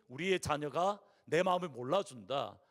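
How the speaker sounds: ordinary talk, nothing marked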